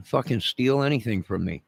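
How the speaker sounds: ordinary talk, nothing marked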